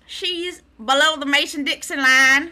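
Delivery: high, loud mock Southern twang; put-on Southern accent. Host announces friend is down South, mock Southern accent. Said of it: "I'm doing a Southern accent".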